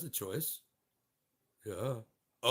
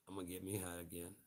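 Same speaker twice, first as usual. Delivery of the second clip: normal talking voice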